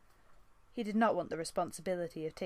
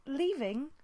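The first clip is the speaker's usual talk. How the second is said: higher, louder rising echo of disbelief; dismayed echo; 8 semitones higher, 4 dB louder than usual. Acted. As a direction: repeat it in dismay. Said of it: "echoed in dismay".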